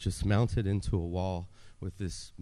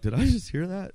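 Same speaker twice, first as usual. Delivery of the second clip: disbelief; 9 semitones higher, 4 dB louder than usual. Retold or imitated. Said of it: "I'm like".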